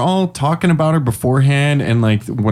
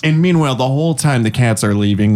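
ordinary talk, nothing marked

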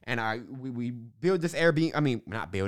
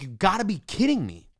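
retold outburst, pitched up in exasperation; mad, incredulous; 4 semitones higher than usual. Founder reacting to the teacher who backed out, mad disbelief. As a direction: replay being mad, voice jumping higher. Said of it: "I was so mad".

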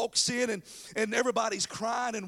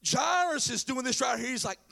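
ordinary talk

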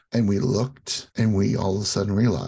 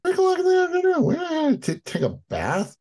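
mocking kid whine; faster than usual. Kids protesting mom in squeaky voice.

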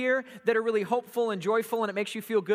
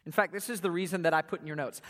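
usual speaking voice

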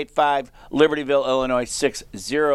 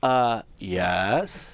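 low, understated admission; teacher's sheepish reply; 5 semitones lower, 3 dB quieter than usual. Retold or imitated. Joe answering the kids who heard the story, understated. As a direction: drop lower for a reluctant yes. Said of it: "run into his class".

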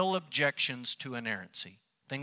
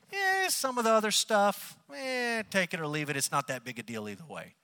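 mock dismissive; 5 semitones higher than usual. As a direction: wave it away as unimportant. Voicing doubters shrugging off Scripture.

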